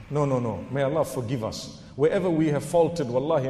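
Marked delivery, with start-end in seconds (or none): none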